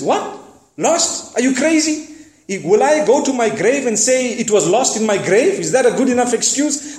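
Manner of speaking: what, lost? are you mad; shepherd aghast at being told to lie; incredulous outburst. Retold or imitated. Shepherd's outraged reply retold.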